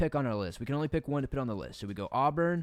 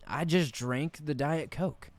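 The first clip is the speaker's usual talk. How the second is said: usual speaking voice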